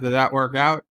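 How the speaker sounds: ordinary talk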